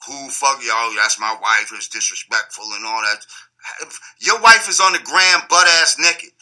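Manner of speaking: ordinary talk, nothing marked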